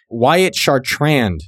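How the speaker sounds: Wyatt Chartrand stretched out very slowly; drawn-out name. Real, not acted. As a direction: savor each syllable of Wyatt Chartrand, slowly. Reads fan's name, then repeats it.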